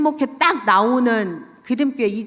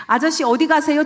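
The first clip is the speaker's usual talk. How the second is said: higher, louder call to a passerby; 5 semitones higher, 4 dB louder than usual. Retold or imitated. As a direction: call out brightly to a stranger on the street. Calls out from car window.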